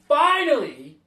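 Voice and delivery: finally, shouted high and loud; exasperated shout. Real, not acted. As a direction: burst out in relief. Exasperated at late Nintendo Direct.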